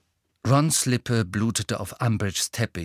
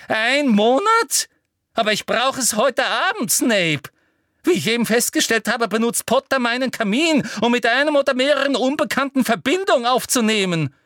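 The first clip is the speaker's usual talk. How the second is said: much higher, louder, furious; shrill outrage; 13 semitones higher, 6 dB louder than usual. A narrator's reading. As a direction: puff up like a toad and shrill. Umbridge swelling with rage. (schrill wütende Umbridge)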